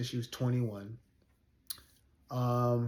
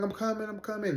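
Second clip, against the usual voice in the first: higher-pitched mimicked reassurance; imitated harried reply; 9 semitones higher, faster than usual. Passenger answers the waiting driver by phone, imitated harried high voice.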